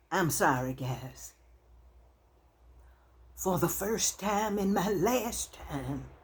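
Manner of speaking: choking up. Real, not acted. Woman recalling a kind classmate, choking up. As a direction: voice catching, slowed and halting, fighting back tears. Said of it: "for being so emotional".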